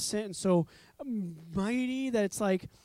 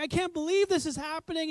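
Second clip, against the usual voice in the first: higher-pitched thrilled disbelief; excited beggar; 6 semitones higher than usual. Acted. Beggar called over by Jesus, excited. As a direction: voice a man whose moment has come. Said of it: "And everyone's excited".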